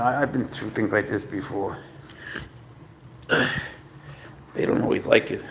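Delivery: ordinary talk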